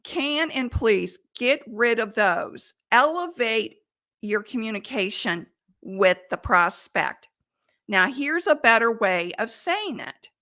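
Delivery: ordinary delivery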